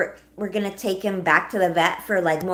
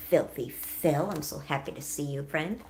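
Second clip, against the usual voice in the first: odd greeting voice; 6 semitones lower, 7 dB quieter than usual. Real, not acted. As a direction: greet them low and lingering, easing off softer. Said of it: "I don't know why I said it like that".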